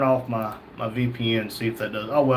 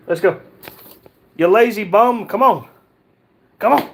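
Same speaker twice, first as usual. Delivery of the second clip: loud, higher call to a lazy dog; 5 semitones higher, 6 dB louder than usual. Real, not acted. Urging his lazy Great Dane to come, loud call. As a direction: holler at the dog to get moving.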